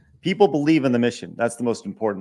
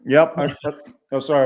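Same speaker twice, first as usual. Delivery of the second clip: talking while crunching ice; chewing ice; 2 semitones higher, 4 dB louder, faster than usual. Real, not acted. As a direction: mouth full of ice. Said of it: "I'm chewing, chewing some ice".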